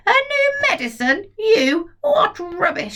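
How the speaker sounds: put-on high, scornful old woman's yell; yelling grandma. Grandma on the roof yelling scornfully at George. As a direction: scoff at the boy like a cranky grandma. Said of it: "she yelled".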